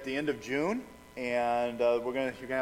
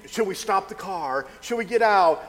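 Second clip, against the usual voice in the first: higher, louder mock panic; retold freak-out, pitched up; imitating berserk daughter; 5 semitones higher, 5 dB louder than usual. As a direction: act out a panicking daughter's frantic questions. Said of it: "my daughter going absolutely berserk".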